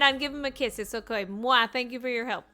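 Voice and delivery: ordinary talk, nothing marked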